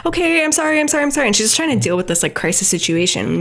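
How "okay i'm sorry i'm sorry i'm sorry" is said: frantic apology. Retold or imitated. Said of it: "she's just like".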